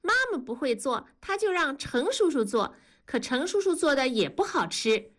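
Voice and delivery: put-on high, eager child's voice; little girl 改改. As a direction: play a delighted little girl. (高声童音，兴奋的小女孩)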